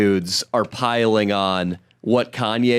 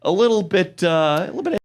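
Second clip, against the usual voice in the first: higher-pitched, hesitant unease; retold wince; 7 semitones higher than usual. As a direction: react with a small, hedging mock wince. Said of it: "But I did go".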